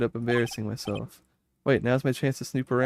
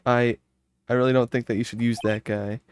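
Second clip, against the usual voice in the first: usual speaking voice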